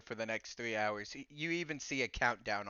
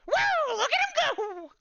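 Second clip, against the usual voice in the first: very high, loud glitching squeal; glitchy creature squeal; 10 dB louder than usual. Acted. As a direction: squeal it like a glitching little robot. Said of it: "glitch out and go".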